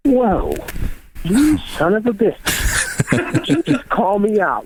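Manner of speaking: high, slow, stunned whoa of disbelief; stunned mock outrage. Father floored by daughter's question, high and slow. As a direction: caught out by his own daughter, half laughing.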